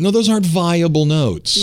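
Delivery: normal talking voice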